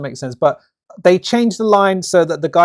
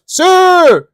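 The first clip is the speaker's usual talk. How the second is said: mock Japanese line; 13 semitones higher, 11 dB louder than usual. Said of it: "The Japanese one goes".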